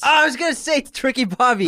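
loud, high-pitched outburst; exasperated, excited yelp; excited blurt. Real, not acted. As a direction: burst out with the near-miss guess. Blurts out his near-miss guess.

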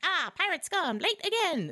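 high squeaky orc voice; a silly little orc calling out; jaunty orc. Retold or imitated. Little orc in a jaunty hat hailing the ship, squeaky.

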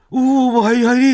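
loud, high, hearty Hakata greeting; mock-hearty Fukuoka stall owner calling you in; imitating Daikichi. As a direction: boom out a friendly welcome. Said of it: "で、それで大吉さんが、".

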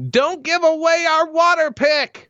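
high, loud mother's yell; hammed-up mom yelling; mock yell. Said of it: "she yells at".

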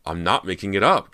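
loud, high, losing control; screamed protest. A narrator's reading. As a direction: shout it in desperate protest. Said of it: "Cody screamed".

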